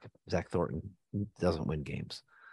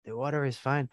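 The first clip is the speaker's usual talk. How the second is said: put-on a light Mexican vato accent, pitched a bit higher; playful mock Mexican accent; 5 semitones higher than usual. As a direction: call him into the water in a hint of a Mexican accent. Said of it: "Was that accent decent?".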